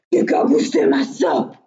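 big bear's loud outcry; loud storybook big-bear voice; Grand Ours. A narrator's reading. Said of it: "s'excria Grand Ours".

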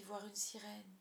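plain reading voice

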